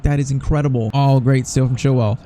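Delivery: ordinary talk, nothing marked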